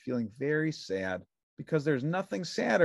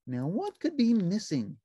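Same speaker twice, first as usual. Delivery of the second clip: high, bright puppet voice; cheerful little puppet; Ernie voice; 9 semitones higher, 4 dB louder than usual. A narrator's reading. Narrator voicing Ernie answering the phone, high puppet voice.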